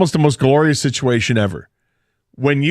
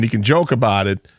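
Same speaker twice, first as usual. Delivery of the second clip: usual speaking voice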